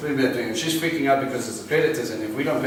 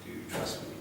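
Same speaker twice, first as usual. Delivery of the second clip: whispered question; soft voice of God; 13 dB quieter than usual. Retold or imitated. God asking the believer softly, hushed whisper. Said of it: "God will go".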